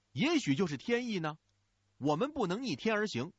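高亢急切地鼓动; 拔高嗓门，激动地劝兄长造反; 大声说. A narrator's reading. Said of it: "大声说". (高声激动地说)

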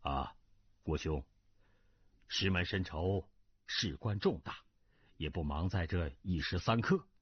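low, measured calming counsel; composed prince. Acted. Prince asking Guo not to rush his revenge, low and composed. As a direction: settle him down, pitched well below the narration.

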